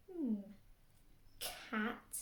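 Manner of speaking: usual speaking voice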